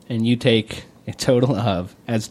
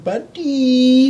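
squeaky high buddy; high silly call; 11 semitones higher, 5 dB louder than usual. Pretend. Escalating echo of buddy.

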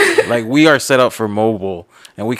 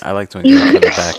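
much higher, louder, teasing innuendo; suggestive innuendo; 4 dB louder than usual. Shown as a demonstration. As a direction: say it like a naughty double meaning. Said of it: "that's how it sounded".

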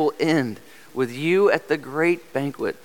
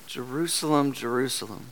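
soft falling lament, quieter each time; 6 dB quieter than usual. Retold or imitated. Preacher voicing Jesus lamenting over Jerusalem, hushed and sorrowful. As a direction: mourn the city's name gently. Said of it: "he cries out in lament".

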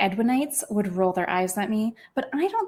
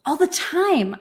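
panting while pushing a door; breathless, slow and strained; out of breath; 7 semitones higher, 5 dB louder, much slower than usual. Acted. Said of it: "I panted".

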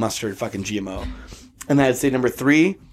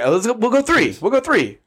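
excited, higher; 9 semitones higher than usual. Real, not acted. Hyping up the round.

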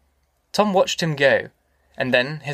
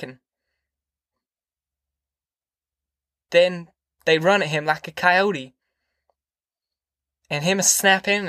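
higher country-mother voice, earnest; troubled mother, pouring out; 4 semitones higher than usual. Acted. Ma telling Tom how Floyd went mad, earnest and troubled. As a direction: let the story spill out in short phrases, pitched up. Ma's words pour out about Floyd.

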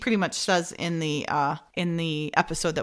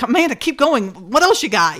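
mock-anxious, raised and louder; higher, louder impatient prodding, retold; anxious urging; 4 semitones higher, 6 dB louder than usual. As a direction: press for more with imitated nervous urgency. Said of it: "Billy's getting really anxious".

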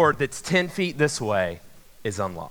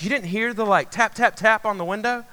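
higher-pitched imitation of an insistent girlfriend; voiced girlfriend; 6 semitones higher than usual. Girlfriend asking about taps at the window, imitated disbelief. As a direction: mimic her disbelieving question, pitched up. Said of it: "And she's like".

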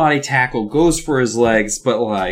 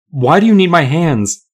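loud, higher-pitched frightened question; alarmed demand; 4 semitones higher, 6 dB louder than usual. A narrator's reading. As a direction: cry it out in acted alarm. Frightened narrator questions villain in story.

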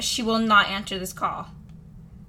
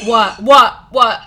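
louder, insistent what; loud repeated what; 11 dB louder, much slower than usual. Real, not acted. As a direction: fire them off louder each time. Reacting to the gasp.

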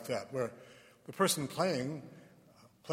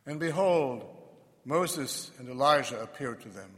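solemn, measured proclamation; gospel reading; 5 dB louder than usual. Acted. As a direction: read scripture aloud to a congregation.

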